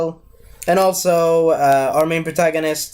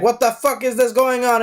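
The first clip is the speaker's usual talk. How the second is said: mock panic; 7 semitones higher than usual. Han in the middle of battle.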